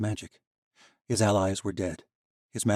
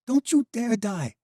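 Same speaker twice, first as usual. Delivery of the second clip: high, loud, slow pleading cry; a woman begging a dying friend; desperate cry; 7 dB louder than usual. Acted. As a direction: order him to live through panic. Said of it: "Valeria cried".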